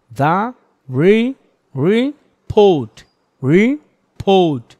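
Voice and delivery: "report" split re-port, stress pushed onto the second syllable; correct pronunciation. Shown as a demonstration. Teacher models report for students, stressed clearly. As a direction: say "report" slowly and loudly, hitting port. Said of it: "the report না".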